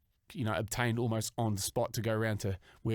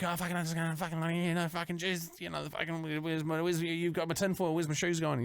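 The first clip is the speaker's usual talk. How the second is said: higher, fast frantic rambling; scattered chat; 5 semitones higher than usual. Retold or imitated. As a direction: babble in disjointed fragments, flustered. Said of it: "just sort of scattered chat".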